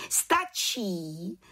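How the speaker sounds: lightly exclaimed order; head witch calling out. A narrator's reading. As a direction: call a stop as head witch. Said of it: "zvolala vrchní čarodějnice".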